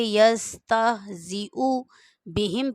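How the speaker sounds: very slow, syllable by syllable; first-read slow. Shown as a demonstration. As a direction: sound it out like a beginner. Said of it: "जब आप पहली दफ़ा पढ़ेंगे तो इस तरह से पढ़ेंगे".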